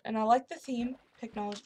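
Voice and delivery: ordinary talk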